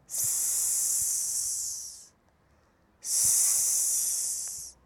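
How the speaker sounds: hissed s sound alone. Shown as a demonstration. Teacher demonstrating the letter sound, a long hissed s. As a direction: hiss a long s for the kids. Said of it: "في اكشن تاني".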